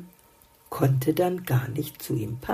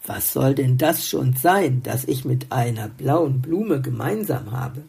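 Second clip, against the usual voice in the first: usual speaking voice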